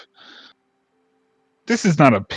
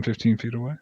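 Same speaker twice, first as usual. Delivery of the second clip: ordinary delivery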